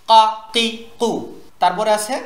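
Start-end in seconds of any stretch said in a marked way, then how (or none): 0.1-1.1 "কা কি কু" short and sharp, each separate; "কা কি কু" with no lengthened vowels; sharp short syllables. Shown as a demonstration. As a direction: say "কা", "কি", "কু" as crisp bursts. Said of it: "উচ্চারণও যেন তীক্ষ্ণ হয়".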